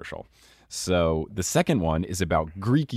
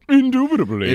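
much higher, louder hammed-up Indubitably; mock posh; 7 dB louder than usual. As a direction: puff up the word like a fancy aristocrat. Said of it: "you get Kawhi at the end going".

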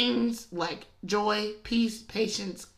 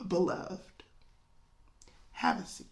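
soft, calm and hushed; soothing, nearly whispered invitation; gentle Jesus; 2 semitones lower, 9 dB quieter than usual. Retold or imitated. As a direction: settle her down tenderly. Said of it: "Jesus is like".

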